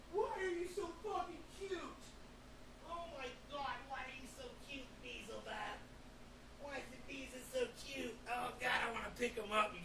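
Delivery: high soft cooing at the dog. Real, not acted. Owner cooing at his cute dog in a high soft voice. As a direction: coo over him, high and hushed.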